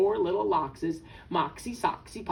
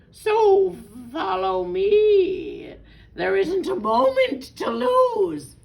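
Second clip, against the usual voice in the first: loud, drawn-out, swooping fox voice; theatrical storybook villain fox; scheming fox; 8 semitones higher, 5 dB louder, slower than usual. A narrator's reading. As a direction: lure them with big, slow, showy phrases. Sly fox lures the birds along.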